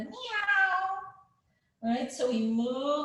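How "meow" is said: high, drawn-out meow; kitty cat meow. Shown as a demonstration. Yoga teacher showing kids the cat pose, high meowing. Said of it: "make the kitty cat sound".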